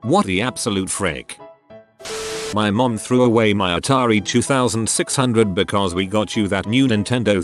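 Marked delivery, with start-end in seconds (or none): none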